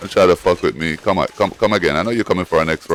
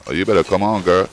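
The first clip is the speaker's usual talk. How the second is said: ordinary delivery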